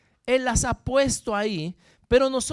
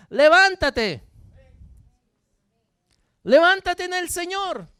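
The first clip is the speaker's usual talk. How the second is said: loud, high, drawn-out command; rousing shout; 6 semitones higher, 9 dB louder than usual. Real, not acted. Pastor answering a despondent believer, rousing loud command. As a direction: shout it out to lift the downhearted. Preacher exhorting the despondent listener.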